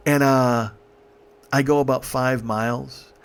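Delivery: ordinary talk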